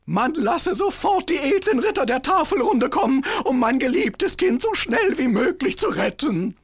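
king voice. Acted.

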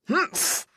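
high, short grumpy snort; grumpy humph. Acted. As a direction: snort it off, a sulking witch. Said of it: "machte die Hexe".

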